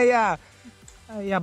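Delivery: usual speaking voice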